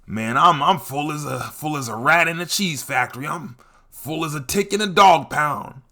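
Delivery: lightly voiced old head, full and satisfied; old head saying. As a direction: play a satisfied uncle after dinner. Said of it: "An old head will hit you with that".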